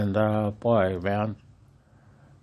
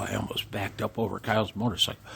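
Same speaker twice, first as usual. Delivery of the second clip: normal talking voice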